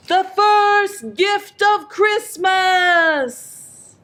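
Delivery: high, very slow proclamation; called out. A narrator's reading. Santa to the crowd, holding the bell high, drawn-out calling out. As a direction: call it out grandly, stretching each word. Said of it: "and he called out".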